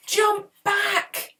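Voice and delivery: high, loud warning shout; yelling. A narrator's reading. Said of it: "Podkin yelled".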